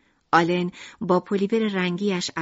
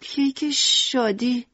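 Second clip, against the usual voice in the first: sadly; 6 semitones higher, much slower than usual. Acted. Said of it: "لوکریس با ناراحتی گفت".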